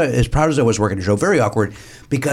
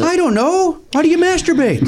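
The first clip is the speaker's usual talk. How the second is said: mock clueless. Mock confusion, joking.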